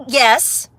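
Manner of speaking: ordinary talk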